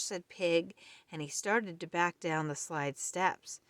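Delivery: ordinary delivery, nothing marked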